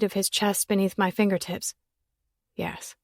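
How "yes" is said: barely voiced, very soft yes; almost inaudible admission; hushed yes. A narrator's reading. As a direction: breathe the answer close to her ear. Nate answers softly, close together.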